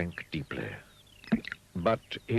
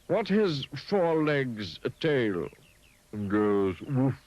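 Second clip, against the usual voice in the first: slow, drawn-out riddle; 7 semitones higher, 5 dB louder, much slower than usual. Acted. The elephant quizzes Mister Dizzy, slowly. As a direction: stretch each part of the riddle.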